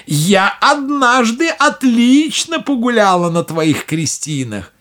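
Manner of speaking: high gloating witch; old witch voice. Acted. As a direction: gloat over past cruelty.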